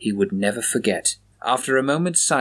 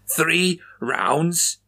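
complaining; 6 semitones higher than usual. A narrator's reading. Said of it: "Chaplin complained".

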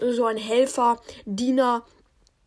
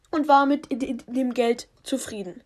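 plain reading voice

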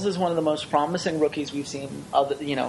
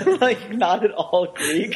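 laughing; 9 semitones higher, 3 dB louder than usual. Real, not acted. Cracking up at the voice.

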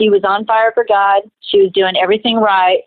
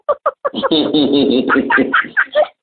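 high laugh at her own joke; real laugh; 8 semitones higher than usual. Real, not acted. Speaker laughing at her own joke with the cohost, high real laugh. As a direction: burst out laughing.

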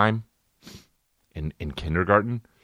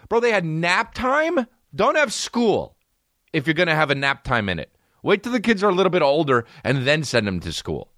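outraged rant; 10 semitones higher than usual. Real, not acted. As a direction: rant in a high, exasperated voice. Mock-outraged rant about nap time.

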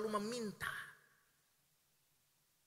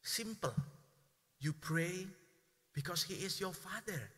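ordinary talk

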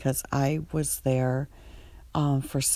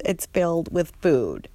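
ordinary delivery

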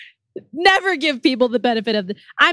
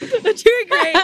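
laughing; 8 semitones higher, slower than usual. Real, not acted. Cheering through laughter.

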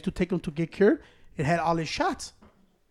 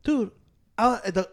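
ordinary delivery

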